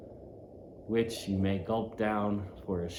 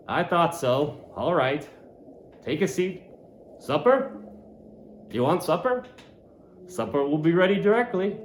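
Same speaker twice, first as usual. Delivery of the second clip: landlord's voice; 6 semitones higher than usual. Acted. Landlord speaks to Ishmael.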